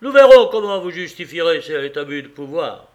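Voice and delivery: a loud outraged outburst; crying out. A narrator's reading. Moneylender shouting his threat at the impassive Austrian, loud and outraged. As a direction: throw the threat out at full volume. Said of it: "finalement s'écria".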